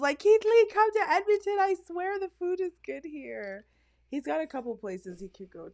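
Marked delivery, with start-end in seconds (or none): 0.2-3.6 calling out. Retold or imitated. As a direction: call out to him across the distance. Calling out to Keith Lee.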